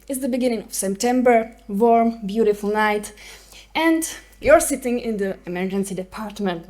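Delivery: Czech-accented English. Real, not acted. Speaker from the Czech Republic.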